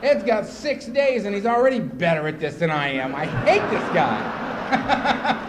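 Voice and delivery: loud, drawn-out exasperation; slow, loud comic seething; hammed-up resentment. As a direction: stretch out the words in mock loathing. Acted resentment of newcomer.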